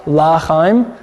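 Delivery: L'chaim said as "lah-chaim"; L'chaim with a full "La" at the front. Shown as a demonstration. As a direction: open the first vowel of L'chaim to a clear ah. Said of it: "a little more of an ah there".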